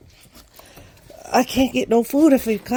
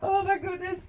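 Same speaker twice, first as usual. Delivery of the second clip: high squeal; 9 semitones higher, 7 dB quieter than usual. Real, not acted. Pinned by dogs.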